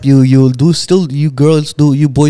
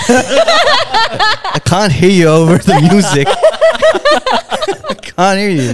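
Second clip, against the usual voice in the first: put-on confused girl at a club; 8 semitones higher, much slower than usual. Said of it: "the chick will be like".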